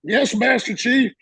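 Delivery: high, slow reply to a superior; mock cowed obedience. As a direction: answer like a startled junior Marine. Intimidated junior answering his chief.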